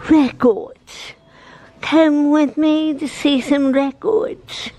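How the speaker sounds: badly faked British accent; horrible British accent. Vlogger inviting viewers to see records, bad British accent. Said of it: "That was a horrible British accent".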